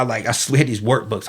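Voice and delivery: normal talking voice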